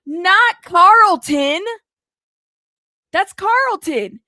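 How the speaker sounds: high, drawn-out gasp of disbelief; shocked disbelief. Real, not acted. Reader stunned by a name in the lawsuit, shocked. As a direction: blurt it out high, stretching the name. Startled reaction to what she reads.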